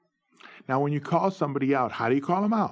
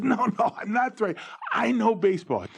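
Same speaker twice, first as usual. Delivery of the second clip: eager protest; 11 semitones higher than usual. Retold or imitated. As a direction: backpedal fast and eager. Ron protesting he's not threatening.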